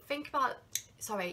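ordinary delivery

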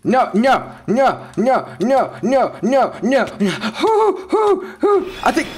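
high, loud frantic pleading; panicked no's. Real, not acted. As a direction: panic as death closes in. About to die in game.

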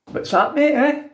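high, sneering taunt at a mate; mocking the pal. After falling out with a pal over a score, high sneering taunt. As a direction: jeer back like a wounded friend.

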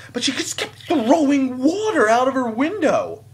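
loud, high exclamation with a laugh; incredulous outburst. Real, not acted. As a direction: cry it out in amused disbelief. Retelling the water-throwing outrage.

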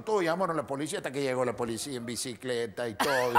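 ordinary talk

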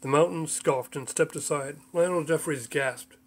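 plain reading voice

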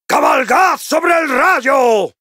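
high, full-throated rallying shout; battle cry. Acted. Dwarf rider ordering a storm charge, high battle cry. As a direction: command the charge from the air.

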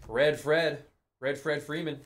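ordinary talk, nothing marked

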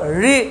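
री with tongue curled back to the roof; heavy curled-tongue री; retroflex ri. Shown as a demonstration. Teacher urging students to say it, retroflex री. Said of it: "मूर्धा से बोलना है".

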